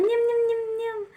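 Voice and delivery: put-on high cooing baby talk at a stroller; high-pitched nyum nyum baby coo.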